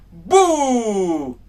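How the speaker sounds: high loud boo to startle; spooky boo. Acted. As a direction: shout boo at the viewer. Scaring viewers again. (grito de susto)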